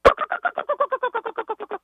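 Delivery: high-pitched rapid turkey gobbling; turkey call. Shown as a demonstration. Caller competing for concert tickets, high turkey-call impression. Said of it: "what is the best turkey call you can come up with".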